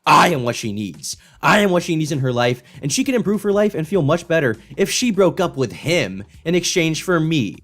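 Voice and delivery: hammed-up lovesick declaration; mock earnest declaration. Commentator mocking the guy's pitch to her, hammed-up and earnest. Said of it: "imagine declaring".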